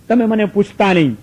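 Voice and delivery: ordinary delivery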